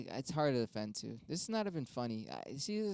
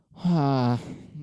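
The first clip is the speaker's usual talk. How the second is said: loud, heavy sigh of exasperation; real riled-up sigh; exasperated sigh; 10 dB louder than usual. Real, not acted. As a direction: let out a big fed-up sigh. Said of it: "Getting riled up".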